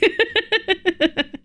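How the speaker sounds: high giggle trailing down; high laugh. Real, not acted. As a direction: let a bright laugh fall away. After the mummy bee.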